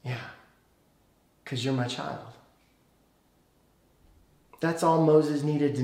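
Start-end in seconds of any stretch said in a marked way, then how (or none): 0.0-2.3 soft, low answer after a long pause; hushed, gentle and lower; tender reassurance. Acted. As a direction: quietly reassure a child. Replays God's tender answer.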